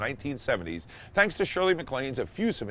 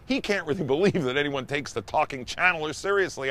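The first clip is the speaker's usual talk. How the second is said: normal talking voice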